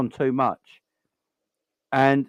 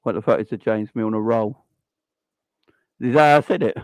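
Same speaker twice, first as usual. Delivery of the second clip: ordinary talk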